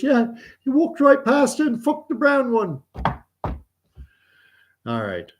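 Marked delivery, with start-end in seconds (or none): 0.0-2.8 put-on high-pitched boy with British accent; little Johnny.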